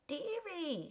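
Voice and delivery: high, coaxing streetwalker voice; woman's coaxing call. Acted. Street woman accosting a stranger, high and coaxing. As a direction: lure him in, pitched high.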